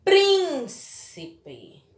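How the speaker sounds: "Príncipe" with long high PRIN, then light ci, pe; stressed syllable demo. Shown as a demonstration. Teacher shows PRIN is strongest, "Príncipe" stretched at the start. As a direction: stretch the first syllable of "Príncipe", separate the rest. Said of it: "é a sílaba mais forte da palavra".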